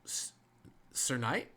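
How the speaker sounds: put-on halting, stammering young guardsman; hesitant stop-and-start greeting; stuttering. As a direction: stumble and pause between words. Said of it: "if you'd stop stuttering".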